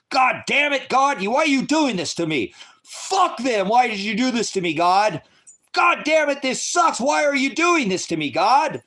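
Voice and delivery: high, loud furious cry at God; loud angry outburst at God; angry yelling at God. Real, not acted. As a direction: rail at heaven, fed up. Raging at God.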